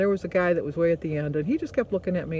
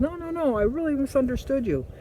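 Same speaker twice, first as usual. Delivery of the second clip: hurried denial; 6 semitones higher than usual. Retold or imitated. Butcher backpedals in retold argument.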